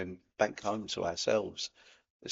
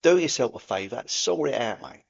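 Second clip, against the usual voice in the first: louder, hearty Cockney geezer; 7 dB louder than usual. Pretend. Host urging viewers to reach out, in a loud Cockney accent. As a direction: give it the cheeky Cockney mate treatment. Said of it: "I'll go in the old Cockney Sparrow here".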